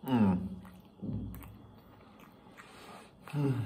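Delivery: chewing while talking; muffled through chewing; mouth full of food. Real, not acted. Seen 2: Cheeks bulge, lips closed and working after bite at -4; open mouth by 6.9.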